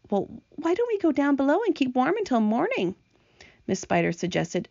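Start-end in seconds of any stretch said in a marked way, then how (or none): 0.1-2.9 higher-pitched story character voice; gentle suggestion voice. Acted. Miss Spider proposes going below till morning, higher suggesting voice. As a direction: lift the pitch to offer an idea.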